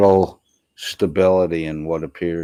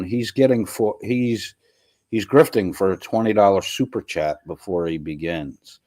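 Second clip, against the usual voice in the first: ordinary talk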